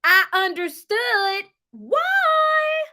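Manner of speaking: slow, high, stretched I understood why; drawn-out realization. Real, not acted. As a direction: let it out slowly with relief.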